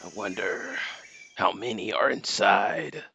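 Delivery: gravelly soldier imitation; snake voice. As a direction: mimic the game hero's voice. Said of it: "Working on my snake voice".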